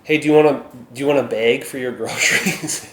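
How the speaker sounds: laughing through a Minnesotan cashier line, "bag" like "beg"; Minnesotan accent. Shown as a demonstration. Vlogger imitating a Minnesota grocery clerk, Minnesotan accent. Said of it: "I sound like".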